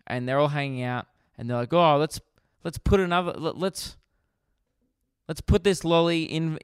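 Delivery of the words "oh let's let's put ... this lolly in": put-on higher, halting child's suggestion; lightly voiced kid egging on friends; kids playing. Innocent kids hanging out doing a challenge, lightly acted child voice.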